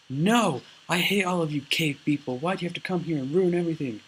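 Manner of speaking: high, loud furious outburst of a retold character; mock tantrum. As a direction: throw a hammed-up tantrum. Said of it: "he went off in a tantrum".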